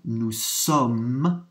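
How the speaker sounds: nous sommes, very slow and drawn out; slow French demo. Shown as a demonstration. Teacher modelling nous sommes slowly for the class. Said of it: "for we are".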